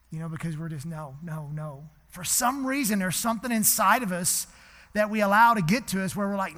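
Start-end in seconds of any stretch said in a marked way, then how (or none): 2.1-6.6 louder, higher, building sermon; climbing preacher's cry; rising preaching. Acted. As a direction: push the point home to the congregation. Preaching builds before quote.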